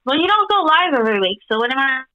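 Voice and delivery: usual speaking voice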